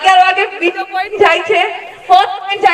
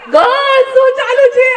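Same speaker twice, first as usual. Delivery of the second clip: playful tease; 8 semitones higher than usual. Real, not acted. Host teasing the girls team.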